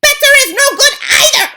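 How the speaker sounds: very high, loud, cackling shriek; a furious witch rejects her coven; witch's shriek. Acted. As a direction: shriek her scorn at them. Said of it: "shrieked the Grand High Witch".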